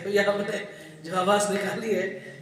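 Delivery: normal talking voice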